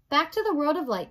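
ordinary delivery, nothing marked

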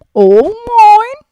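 high, loud squawking seagull demanding its lunch; a bossy gull shrieking for its food; greedy seagull voice. Acted. Seagull voiced in read-aloud book.

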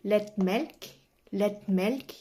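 lættmælk said twice in Norwegian sounds, rising at the end; Norwegian word demo. Shown as a demonstration. Teacher modelling the milk-carton word lættmælk for viewers. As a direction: model lættmælk clearly for learners. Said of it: "แล้วก็คำต่อมานะคะ".

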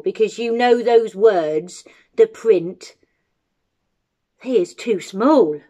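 put-on loud, aggrieved old giant; old giant, grumbling. Old giant complains to Jim about Jack and his eyes, grumbling.

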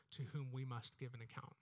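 ordinary talk, nothing marked

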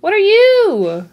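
high, loud, drawn-out what are you; startled yell. Real, not acted. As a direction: shout at the strange creature. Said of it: "Sorry, I'm not yelling at you".

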